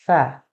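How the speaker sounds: "সা" with a thin stream of air at the teeth; tongue to the upper teeth, release a faint "সা"; faint airy sound. Shown as a demonstration. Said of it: "ক্ষীণ আওয়াজ হবে".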